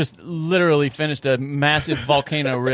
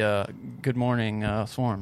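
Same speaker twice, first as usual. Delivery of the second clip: lower, quieter, slow tired greeting; low-energy greeting; 5 semitones lower, 6 dB quieter, much slower than usual. Retold or imitated. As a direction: imitate a worn-out host. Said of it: "You run out a little energy".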